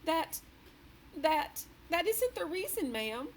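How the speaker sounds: stammering, a touch shaky and frightened; quivering voice. A narrator's reading. The maid trembling as she answers the queen. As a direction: stumble over the words in fear. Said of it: "voice was quivering terribly".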